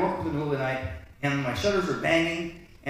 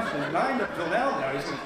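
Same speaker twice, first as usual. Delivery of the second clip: ordinary delivery, nothing marked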